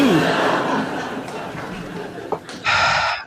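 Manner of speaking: drawn-out weary breathy sigh; a long sigh before the forced apology. Real, not acted. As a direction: let out a long tired breath.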